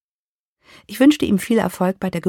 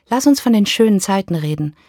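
ordinary delivery, nothing marked

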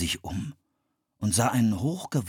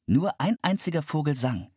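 usual speaking voice

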